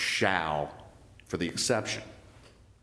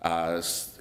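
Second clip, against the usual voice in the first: ordinary talk, nothing marked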